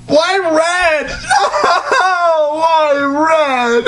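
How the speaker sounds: high shrieking laugh through the words; can barely get the words out for laughing. Real, not acted. Reading the fic aloud to a friend, shrieking with laughter. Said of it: "cracking up at the fic".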